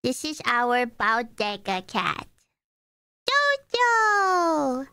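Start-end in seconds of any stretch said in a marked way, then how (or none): 3.3-4.9 calling the cat. Real, not acted.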